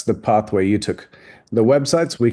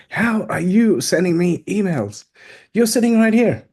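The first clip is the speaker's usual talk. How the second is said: amazed disbelief; 8 semitones higher than usual. Retold or imitated. Seminar guests asking the host, amazed and high-pitched. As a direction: sound baffled that emails arrive from someone beside you. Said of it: "the people on my table are tapping me going".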